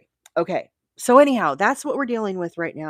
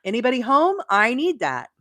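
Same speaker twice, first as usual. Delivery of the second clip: higher, pushy dog voice; 6 semitones higher, 4 dB louder than usual. Pretend. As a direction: voice the dog demanding loudly. Dog's imagined demand voiced.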